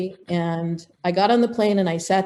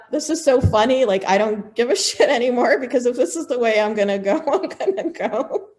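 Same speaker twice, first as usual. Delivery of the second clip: laughing through the words, higher; 5 semitones higher than usual. Real, not acted. Laughing at nearly dying on a broken plane. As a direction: giggle it out in disbelief. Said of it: "and I just thought".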